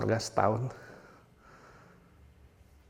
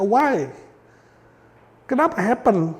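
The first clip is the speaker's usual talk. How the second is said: mock anguished why; 6 semitones higher, slower than usual. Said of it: "Bisa bertanya".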